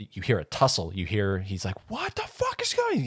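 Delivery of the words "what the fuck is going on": much higher panicked cry; alarmed yell. Retold or imitated. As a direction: shout it in sudden alarm. Husband reacting to the tussle.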